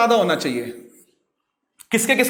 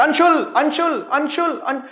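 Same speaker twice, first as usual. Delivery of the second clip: fading echo of a name; echo demo; 4 semitones higher than usual. Shown as a demonstration. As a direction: let the echo fade away. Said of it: "मैंने बोला अंशुल".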